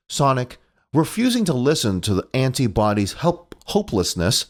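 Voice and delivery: ordinary talk, nothing marked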